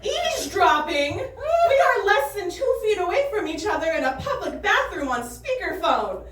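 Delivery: high, exasperated disbelief; incredulous. Retold or imitated. Comic to the woman in the stall, incredulous and high. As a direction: protest how absurd this is. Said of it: "I'm like".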